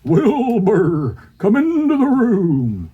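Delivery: high, whinnying horse voice; talking horse. Acted. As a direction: call out like a neighing horse. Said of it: "What does the horse say?".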